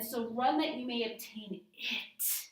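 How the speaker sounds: IT set apart and punched at the end; stress on IT. Shown as a demonstration. Teacher points to the eternal gift, stressing IT. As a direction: run through, then land firmly on "it". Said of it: "the it, the eternal gift".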